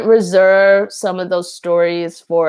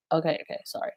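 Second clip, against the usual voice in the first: hushed apology; 11 dB quieter, much faster than usual. Real, not acted. Reining herself in.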